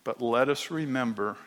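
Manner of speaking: soft, steady prayer voice; closing prayer. Acted. Pastor leading congregation in prayer, quiet and reverent. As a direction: pray quietly to the Father.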